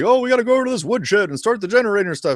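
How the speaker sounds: high, fast nervous camper voice; lightly voiced anxious teen; panicked player. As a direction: fret like a scared counselor. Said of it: "you might have to be like".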